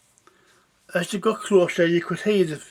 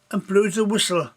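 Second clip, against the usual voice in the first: ordinary delivery, nothing marked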